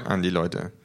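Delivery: usual speaking voice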